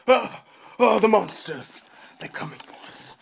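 hammed-up gasps sinking into a whisper; mock panic. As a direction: play scared of movie monsters, high then hushed. Acts out Cloverfield monster panic.

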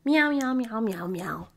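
playful meows that fade away; cat meows. Acted. Greeting the kitty girl with playful, fading meows. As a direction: meow like a cat, trailing off.

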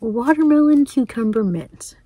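normal talking voice

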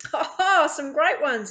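hearty, loud ha-ha reply; laughing. A narrator's reading. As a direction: laugh loudly into the answer. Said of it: "the Australian man laughs".

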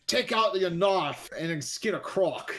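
slow, lightly put-on Australian accent; a hint of a bad Australian accent; mock Australian accent. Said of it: "and I'm like".

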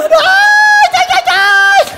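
high, loud cries of ow; hammed-up pain cries; yelling in pain. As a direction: wail each ai in comic agony. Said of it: "eu gritando".

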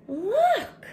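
excited cry. Acted. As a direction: point out the surprise with delight. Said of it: "cried Bear".

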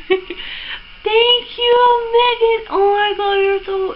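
high, squealing thanks; real giddy, high-pitched gratitude; gushing gratitude. Real, not acted. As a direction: thank a friend, overwhelmed with joy. Gushing thanks to gift giver.

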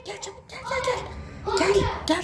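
urgent hushed whisper; whispering while hiding. Real, not acted. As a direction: hurry them in without a sound. Hushing child while hiding.

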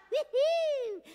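high loud woo-hoo; joyful shout of woo-hoo; whoop. Acted. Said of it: "Everybody scream and shout".

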